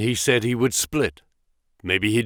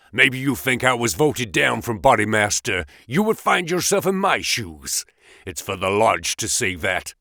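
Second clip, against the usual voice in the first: usual speaking voice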